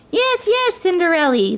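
high-pitched little mouse voice; eager mice. A narrator's reading. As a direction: squeak agreement like tiny mice.

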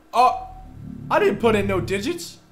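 loud, high shocked protest; startled outburst. Real, not acted. Player protests at the keypad, loud and startled. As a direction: blurt it out in alarm. Keypad goes wrong unexpectedly.

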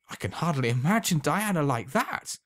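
put-on a young lover carried away with joy; overwhelmed with joy. A narrator's reading. Alan picturing Diana devoted to him, joyful cry. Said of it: "cried Alan, overwhelmed with joy".

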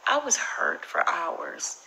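usual speaking voice

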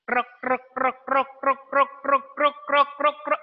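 soft, repetitive staccato croaks; low-key pretend toad croak. Shown as a demonstration. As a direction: imitate a toad in short even bursts.

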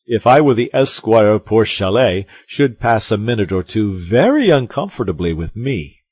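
loud blustering threat; crying out. A narrator's reading. Porthos boasts what he would do to Rochefort, crying out. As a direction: declare it forcefully, with bluster. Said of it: "cried Porthos".

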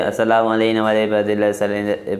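fast, hurried "السلام علینا" as people gabble it; rushed reading. Shown as a demonstration. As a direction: rattle it off quickly. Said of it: "فٹافٹ پر لوگ پڑھ دیتے ہیں".

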